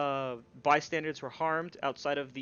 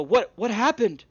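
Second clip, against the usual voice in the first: higher, louder startled guard voice; alarmed guards; 8 semitones higher, 7 dB louder than usual. Acted. Duergar guards arriving at the scene, alarmed and high. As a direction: demand to know what happened, alarmed. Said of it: "and they go".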